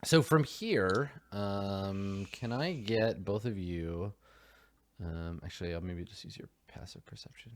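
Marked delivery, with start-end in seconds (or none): 5.0-7.5 whispered aside, much softer and quicker; thinking aloud under the breath. Real, not acted. GM whispering to himself about the rules.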